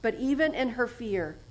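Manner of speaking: ordinary talk, nothing marked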